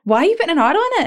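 mock-exasperated protest at a mum's pronunciation; exasperated complaint. Complaining to mum about her added R, exasperated.

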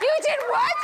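high, loud stunned question; astonished disbelief. Retold or imitated. Hearing the girl now follows Jesus, shocked and high. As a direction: pitch way up in disbelief. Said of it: "And I was like".

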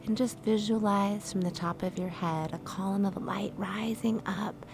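soft, calm meditation voice with whispered lines; guided meditation. Acted. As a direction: guide them gently into stillness.

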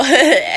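big laugh breaking out; loud burst of laughter. Real, not acted. Laughing at coach reply.

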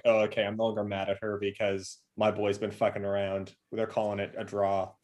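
low soft mock dad calm; mock placid dad. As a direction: play the father shrugging it off. Dad voiced, no longer mad.

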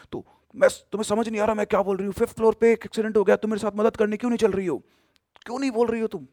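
high, loud, fast furious demand; rising menace, the voice turning eerie by the end; angry yelling. Retold or imitated. Ghost woman demanding the girl help her, fast angry yelling. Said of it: "बहुत तेज गुस्से में बोली".

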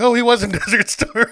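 laughing through the words; high laughing realization. Retold or imitated.

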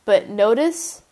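ordinary talk, nothing marked

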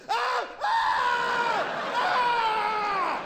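high shrill screams of terror; hammed-up dying animal screams; screams of prey. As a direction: scream like something being eaten. Animals being eaten.

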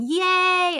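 high, loud, drawn-out cheer. Retold or imitated. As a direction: whoop it out in celebration. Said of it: "I started going".

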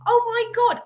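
high, loud imitated exclamation; retold surprise, hammed-up; mock gasp. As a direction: gasp as if spotting a bump. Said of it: "And she went".

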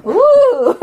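giggly, squealed ooh; laughing ooh. Retold or imitated. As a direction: let the ooh bubble with laughter. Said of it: "and I'm like".

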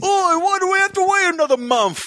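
high-pitched hammed-up Cockney whine; mock Cockney accent. Moaning to co-host about release delay, mock Cockney accent.

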